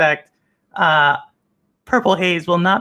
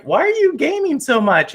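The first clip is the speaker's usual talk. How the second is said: imagined wife; 8 semitones higher than usual. Retold or imitated. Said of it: "your wife's like".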